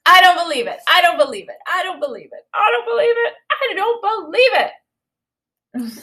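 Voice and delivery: mock outraged mother, rising to a near cry; disbelieving mom. Mom shocked at her son's stained jeans, hammed-up disbelief. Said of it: "She said it five times".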